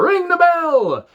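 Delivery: put-on Dario Cueto accent. Dario Cueto ordering the bell rung, slow, high accented cry. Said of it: "Can't do that accent at all".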